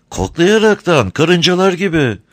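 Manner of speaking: high, sweet-talking crafty smuggler; sly, sugary old rogue; honeyed voice. A narrator's reading. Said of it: "tatlı konuşuyor".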